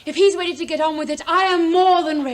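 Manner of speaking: loud, quick declaration building in force; emphatic eagerness. Acted. Daughter insisting she is ready, emphatic. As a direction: insist at full voice, building to a peak.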